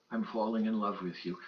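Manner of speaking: quiet. Retold or imitated. Retold thought, soft.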